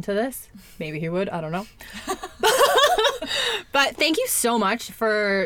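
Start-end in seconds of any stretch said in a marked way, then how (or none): 1.7-3.7 high-pitched burst of laughter. Real, not acted. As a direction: let a high giggle out. Laughs about the ex listening.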